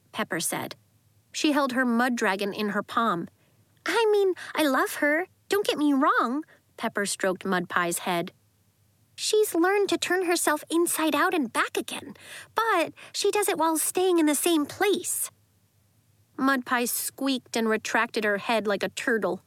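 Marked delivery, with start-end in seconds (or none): 3.9-15.3 put-on high young girl voice. A narrator's reading. Pepper explaining about pet.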